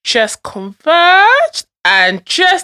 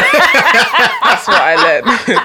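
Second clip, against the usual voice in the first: laughing; 4 dB louder, much slower than usual. Real, not acted. Laughs at own point.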